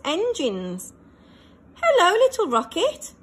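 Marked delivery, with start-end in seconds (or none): 1.8-3.1 high, loud friendly call. A narrator's reading. Shuttle greeting Little Rocket, loud friendly call. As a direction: call across space to a friend. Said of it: "called Sammy".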